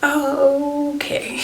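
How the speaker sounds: mock shock, spelling out the news; stunned disbelief. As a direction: sister repeating the news in disbelief. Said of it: "And she's like".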